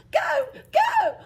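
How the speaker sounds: high, loud go go; urgent shout. Acted. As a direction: yell at the horses. Urging carriage to go.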